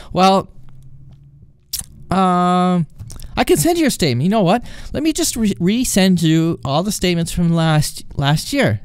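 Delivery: stall, then pitch the offer brightly; agent improvising. Retold or imitated.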